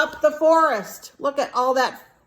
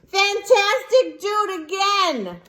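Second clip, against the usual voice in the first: shouting; 9 semitones higher than usual. Acted. Elizabeth cheering the tired dragon on, high sing-song shout. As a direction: cheer him on to do it again. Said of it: "but Elizabeth shouted".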